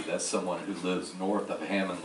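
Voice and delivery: normal talking voice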